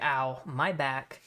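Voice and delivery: slow, lightly pained ow; half-joking wince of pain; mock pained. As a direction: drag out a fake hurt-back groan. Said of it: "And then Darby's like".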